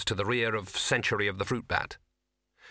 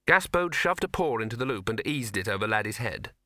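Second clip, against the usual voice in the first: ordinary delivery, nothing marked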